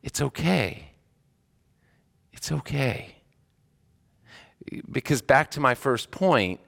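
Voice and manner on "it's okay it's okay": soft, slightly lifted, soothing; tender reassurance. Retold or imitated. Chaplain comforting someone in tears, tender and soft. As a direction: console gently, leaving space between.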